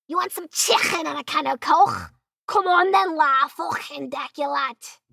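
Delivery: hammed-up Scouse accent; exaggerated Liverpool accent, drawing out the end. Shown as a demonstration. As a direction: mimic a Liverpool local, throaty Scouse sounds. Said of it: "that's how Liverpool people talk".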